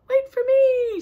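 high, loud squeaky plea; little mouse voice. Acted. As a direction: call out like a tiny mouse left behind. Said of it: "says the little mouse".

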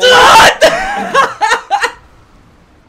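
howling with laughter; loud shrieking laugh. Real, not acted. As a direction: cackle at the top of your lungs. Wild laugh at partner's death.

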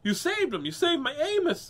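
high, loud grateful exclamation; high-pitched overjoyed mother; woman crying out. Acted. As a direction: cry out in storybook relief, high and loud. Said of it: "the woman cried".

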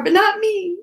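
high, loud sing-song boast; playful and smug. Real, not acted. Joking to viewers, a high loud smug boast. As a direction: chirp it to claim the exception. Playful self-exempting joke.

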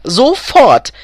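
loud, high, sharp order; hissed command. A narrator's reading. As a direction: hiss the order with force. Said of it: "zischte Elfriede".

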